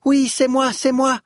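put-on high, loud, triumphant child voice; very high eager little voice; excited child. A narrator's reading. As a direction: burst out proudly like a little child. Child proudly claims the sound.

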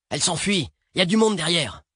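high-pitched warning cries; urgent alarm. Acted. Passenger spots pursuers behind the fleeing girls, urgent cries. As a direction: call out fast-breaking danger.

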